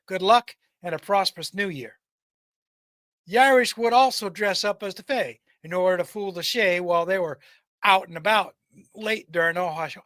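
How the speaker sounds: ordinary delivery, nothing marked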